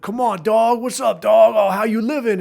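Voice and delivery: loud mock "what's up dog" bro voice; mocking bro talk. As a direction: ham up the goofy DJ dude. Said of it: "everybody was dog".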